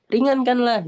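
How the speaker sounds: high, loud mock plea; pleading. The damned beg the keepers for lighter torment, pleading. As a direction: imitate someone begging out loud. Voices the damned begging for relief.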